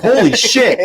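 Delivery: high, loud shout breaking into a laugh; a jumpy scared yell, laughing; startled yelp. Retold or imitated. Retelling meeting the giant brother, a startled laughing shout.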